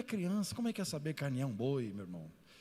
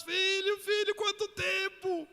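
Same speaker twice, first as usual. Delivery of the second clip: sisters' joyful cry; 5 dB louder, slower than usual. Retold or imitated. Sisters greeting their brother.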